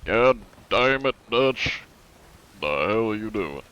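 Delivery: put-on Southern American cowboy accent; Southern American drawl impression; Arthur Morgan. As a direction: curse like an exasperated Southern outlaw. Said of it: "Southern American, good old Arthur Morgan".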